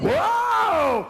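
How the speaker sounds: high, loud falling wail; wife's labour howl. Retold or imitated. Wife wheeled into hospital, high pained howl. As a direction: wail through a contraction. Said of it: "And she's going".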